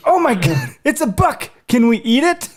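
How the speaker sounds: put-on very high, giggly toddler voice; delighted little girl. Dad voices his daughter seeing the dead buck. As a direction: squeal it like a thrilled three-year-old.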